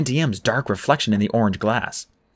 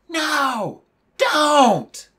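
high, held-back scream from afar; Annabeth screaming. A narrator's reading. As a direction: cry out in high-pitched alarm. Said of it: "Annabeth screamed".

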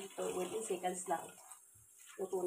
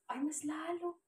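high-pitched, rising yelp of dismay; dismayed exclamation; 10 semitones higher, 3 dB louder, slower than usual. Real, not acted. Vlogger fussing over a shot that got uglier, high-pitched dismay. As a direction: exclaim it much higher, in real dismay.

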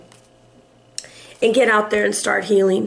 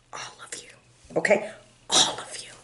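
whisper; 6 semitones lower, 10 dB quieter than usual. Real, not acted. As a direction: whisper it softly. Tender whisper to viewer.

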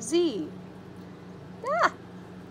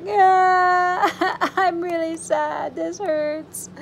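hammed-up wail into a sad whimper; wail, then whimper like a hurt little letter; mock crying; 4 semitones higher, slower than usual. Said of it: "J and K about to cry".

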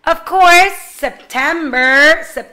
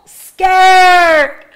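louder, a bit higher mock fright; a teacher acting scared for her students, louder; 5 semitones higher, 8 dB louder than usual. Shown as a demonstration. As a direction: yelp the word like something startled you. Acting scared for feelings lesson.

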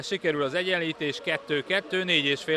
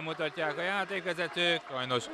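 ordinary talk, nothing marked

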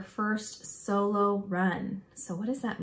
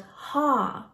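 raised-pitch quote of a musher's command; imitated yell; 5 semitones higher, 5 dB louder than usual. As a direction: mildly call it out like the musher. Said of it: "And then she yelled".

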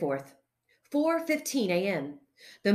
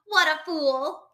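scornful; 7 semitones higher, 5 dB louder than usual. A narrator's reading. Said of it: "added Frank".

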